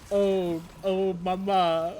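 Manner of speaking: slow, hammy Italian "oh mama"; lightly put-on jolly Italian chef; Italian accent. Caster slips into pizza chef.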